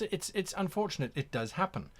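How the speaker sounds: usual speaking voice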